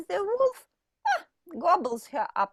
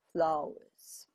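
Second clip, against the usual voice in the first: menacing whisper; 8 semitones lower, 5 dB quieter than usual. Acted.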